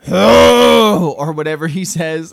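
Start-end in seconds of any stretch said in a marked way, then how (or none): 0.1-1.1 higher, louder mock oh; a hammed-up disappointed sigh-word; Splinter's groan. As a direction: podcaster imitates Splinter after pizza hits his head. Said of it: "Master Splinter going".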